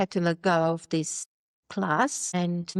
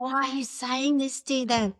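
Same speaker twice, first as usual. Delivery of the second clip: mock angry father; 6 semitones higher than usual. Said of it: "My father was pissed".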